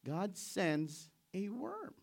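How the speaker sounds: ordinary talk, nothing marked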